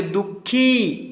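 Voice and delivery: दुखी said very slowly, खी stretched; slow long ee. Shown as a demonstration. Teacher slowly stretches दुखी so children hear the long खी. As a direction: drag out दुखी, hold the long खी. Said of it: "खी थोड़ा सा लंबा उच्चारण हो रहा है".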